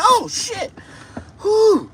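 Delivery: very high squealing cry of shock. Retold or imitated. Seeing the woman's photo, high shocked squeal. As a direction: squeal like you just saw a monster. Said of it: "I was like".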